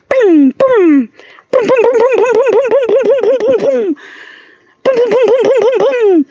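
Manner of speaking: very high booming zap noises, hammed up; gun sound effect. Shooting bad energy away with mock blaster booms. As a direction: fire off silly blaster booms.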